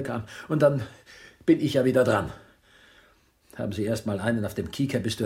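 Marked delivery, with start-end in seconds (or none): 0.5-2.3 near-shouting in a fluster; panicked outburst, louder with each word; rising panic. Acted. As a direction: voice climbs high and loud in alarm. Panicking over the police investigation.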